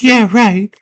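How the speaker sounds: put-on high, dismissive girl voice; headstrong girl. Girl doubting Hoggle, high headstrong voice. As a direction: brush it off in a high girl's voice. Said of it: "She's all headstrong".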